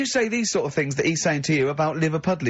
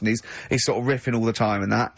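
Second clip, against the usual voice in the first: ordinary delivery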